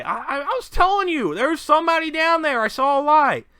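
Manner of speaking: high, loud protest in character; worked-up lookout insisting loudly; insistent shouting. Acted. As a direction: yell it, pitch jumping way up. Game master voices an insistent lookout.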